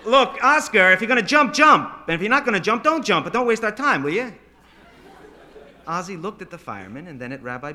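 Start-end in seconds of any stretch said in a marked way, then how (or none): 0.0-4.3 loud, high-pitched scolding; fed-up yell, pushing every phrase; exasperated shout. Acted. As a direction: holler impatiently up to someone on a roof. Fireman scolding boy on roof.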